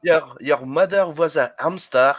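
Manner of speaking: Monty Python style French taunter; mock French accent. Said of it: "go see the Monty Pythons".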